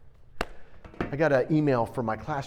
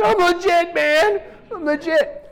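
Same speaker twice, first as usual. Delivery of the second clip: high, loud protest of innocence; insisting loudly; 7 dB louder, slower than usual. Retold or imitated. To doubting hometown friends, a loud high insistence. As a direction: swear you're legit, loud and high.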